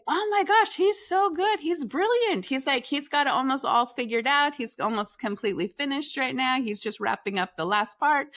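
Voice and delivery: high, gushing amazement; impressed dad. Retold or imitated. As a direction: rave like you can't believe it. Husband raves about son's work.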